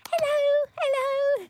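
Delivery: very high squeaky squirrel voice; tiny squeaky critter. Pretend. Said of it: "lovely little squirrel voice".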